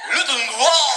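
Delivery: mock French. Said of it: "But French is like".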